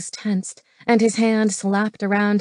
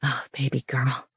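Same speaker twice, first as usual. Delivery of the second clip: low, soft murmur by the ear; hushed, low-pitched male endearment; breathed; 7 semitones lower, 7 dB quieter than usual. Acted. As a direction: breathe it softly into a lover's ear. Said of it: "he breathed by her ear".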